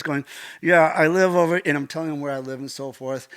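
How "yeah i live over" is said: louder, jaw barely working; talking with broken jaw. Retold or imitated. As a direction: imitate speech through a broken jaw. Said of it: "so I was talking like this".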